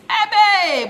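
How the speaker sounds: sudden high shout of amazement; loud, high cry of wonder; exclamation. Real, not acted. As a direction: burst out in delight. Excited listing of Ghana's minerals.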